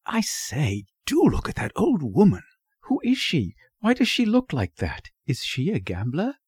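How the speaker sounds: put-on high-pitched, boyish wondering; young boy's hushed, high curiosity; curious boy. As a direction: pepper them with fascinated questions. Voices curious young Henny.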